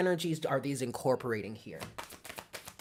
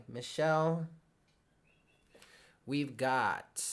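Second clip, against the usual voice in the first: usual speaking voice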